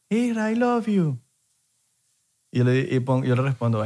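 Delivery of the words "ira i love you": mock fan call. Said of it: "me grita".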